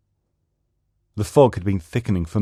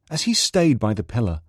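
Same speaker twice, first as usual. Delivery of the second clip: usual speaking voice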